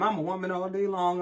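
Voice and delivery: usual speaking voice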